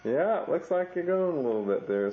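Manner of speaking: ordinary talk, nothing marked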